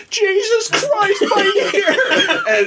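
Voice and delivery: high, slow mock cry of pain, laughing; pained friend. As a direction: wail like your ear was just cut. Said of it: "Daniel's like".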